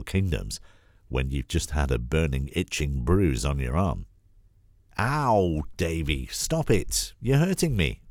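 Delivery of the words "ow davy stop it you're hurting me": put-on high, pleading little-girl cry of pain; child's scream. A narrator's reading. Little girl shouting at her pinching brother, high and pained. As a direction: yelp it out like a hurt little girl. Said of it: "Sophie screamed".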